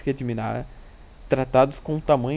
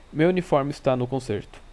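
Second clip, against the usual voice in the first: plain reading voice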